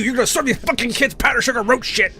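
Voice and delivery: high, furious accusation; retold angry yell. Baker accusing the manager, high and furious. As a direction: hurl it at the manager.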